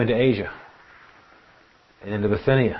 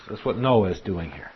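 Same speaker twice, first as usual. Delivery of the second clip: normal talking voice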